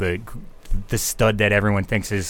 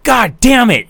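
much higher, louder mock curse; cursing the other team's goal; frustrated curse; 13 semitones higher, 8 dB louder than usual. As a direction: spit it out at the goal against. Opponent scores, retold curse.

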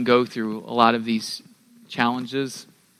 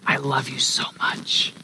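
whisper. Retold or imitated. Father whispering a bedtime secret into his son's ear, hushed. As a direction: breathe it gently like a bedtime secret. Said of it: "I'll whisper in his ear".

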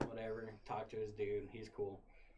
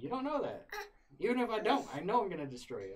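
heated threat; 8 semitones higher, 5 dB louder, faster than usual. Retold or imitated. Threatening roommate in fight.

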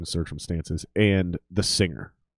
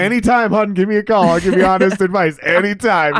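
high, loud, sugary mock voice; mock sweet offer; 10 semitones higher, 8 dB louder than usual. Drummer mock-offering the singer honest advice, high and sugary. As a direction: play a syrupy friend offering help.

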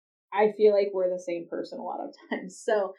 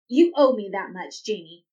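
mock angry demand; 5 dB louder than usual. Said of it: "goes for the anger route".

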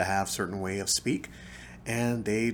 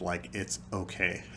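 normal talking voice

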